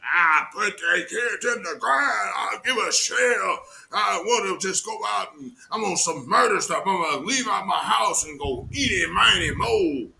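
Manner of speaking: high, shouted gangster bluster; mocking the rapper's murder talk, yelled; mock rapper boast. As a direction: play the menacing rapper, hammed up. Quoting the rapper's posts.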